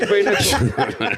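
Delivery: laughing drawl. Acted. Slow laughing repeat.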